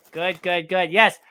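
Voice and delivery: ordinary talk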